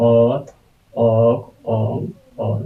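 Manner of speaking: very slow, stretched letter sounds; teacher slowly sounding out ᱚᱛ ᱚᱜ ᱚᱝ ᱚᱞ; drawn-out letter names. Shown as a demonstration. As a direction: stretch each letter name out for the class. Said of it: "ᱛᱚ".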